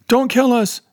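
high, desperate plea; pleading. A narrator's reading. Ray begging the stranger above the grave, high pleading. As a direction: beg for your life, voice up high. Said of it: "Ray pleaded".

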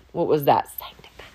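mock baffled whisper, fading away; puzzled whisper. Listener puzzled by big church words, mock whisper.

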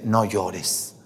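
soft, hushed consolation; tender comfort. Acted. Jesus comforting the weeping widow, softly tender. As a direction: gently soothe a crying widow. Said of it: "le dice".

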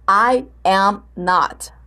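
"I am not" slowly, with gaps between words; slow, separated model answer; word-by-word demonstration. Shown as a demonstration. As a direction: set out "I", "am", "not" one at a time. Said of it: "Vai colocar lá".

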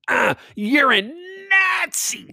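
high, slow mocking screech; mocking shrill woman. Mimicking the woman who calls him a Nazi, high and slow. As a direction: parody her shrill accusation. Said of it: "what's coming out of her mouth hole".

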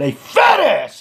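high, angry call-out; retold outburst at a stranger; losing it. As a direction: holler the insult, pitch jumping up. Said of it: "but I lost my fucking shit".

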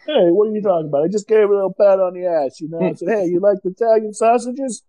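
mock-indignant New Jersey protest; hammed-up New Jersey accent. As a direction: play a pushy New Jersey flirt. Plays indignant Jersey guy excusing himself.

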